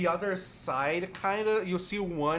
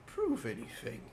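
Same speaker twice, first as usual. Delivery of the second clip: put-on very old man; 5 dB quieter than usual. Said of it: "a very frail old man".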